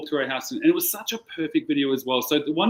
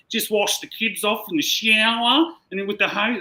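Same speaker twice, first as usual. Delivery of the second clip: imitating a British friend with a higher British accent; 8 semitones higher, 3 dB louder than usual. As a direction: put on a lilting British accent, pitched up. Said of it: "'cause she's British".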